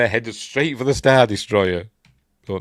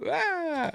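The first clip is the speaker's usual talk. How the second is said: a high, imitated falling scream; mock the yell of someone dropping away; cartoonish high falling yelp; 4 dB quieter than usual. Luke falls down the shaft.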